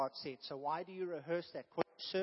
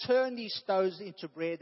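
mock Satan quote; 2 semitones higher, 8 dB louder than usual. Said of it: "Satan didn't say".

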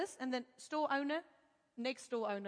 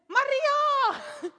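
much higher, loud reveal; triumphant answer; 13 semitones higher, 9 dB louder than usual. Retold or imitated. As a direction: announce the name in triumph.

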